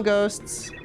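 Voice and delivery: ordinary talk, nothing marked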